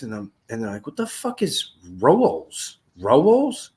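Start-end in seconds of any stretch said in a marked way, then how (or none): 0.9-2.8 higher, drawn-out puzzlement on rolls; baffled list reader. Retold or imitated. Housemate puzzling over the misspelt grocery list, baffled. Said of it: "I hear someone reciting the fucking list". 3.0-3.7 rolls pitched high and rising, louder; baffled list reader. Retold or imitated. Housemate reciting the misspelt list, baffled question on rolls. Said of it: "I hear someone reciting the fucking list".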